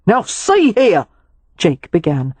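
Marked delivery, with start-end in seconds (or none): 0.1-1.1 an offended valet drawing himself up; outraged objection. Acted. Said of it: "outraged at this violation".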